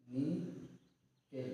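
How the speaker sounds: Nickel drawn out like dictation; drawn-out teacher voice. Shown as a demonstration. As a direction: stretch nickel very slowly and let it fall. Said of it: "क्या?".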